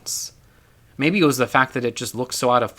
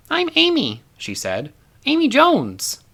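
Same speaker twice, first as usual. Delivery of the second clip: put-on little girl voice; 14 semitones higher, slower than usual. A narrator's reading.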